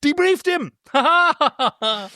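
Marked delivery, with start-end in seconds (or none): 0.0-0.7 high, gleeful exclamation. Real, not acted. Delighted at the pun. 0.8-0.9 hearty laughter. Real, not acted. Laughing at own pun.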